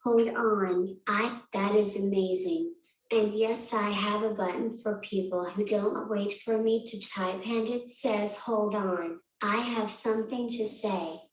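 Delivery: robotic text-to-speech voice; flat synthetic computer voice. Real, not acted. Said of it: "I use an augmentative alternative communication device to talk".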